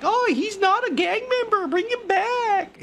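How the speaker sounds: high whining plea, slow at the end; mock whiny crying. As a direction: whine it like crybabies mocking the protesters. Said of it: "they're all crying, like".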